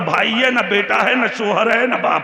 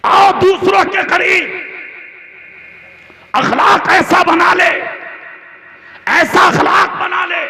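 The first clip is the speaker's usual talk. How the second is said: higher, louder impassioned sermon, building to shouts; preaching at full force; 6 semitones higher, 3 dB louder than usual. Acted. Preacher rousing the Talakpur crowd, impassioned full-force sermon. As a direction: preach it like a fiery mosque orator, rising to a roar.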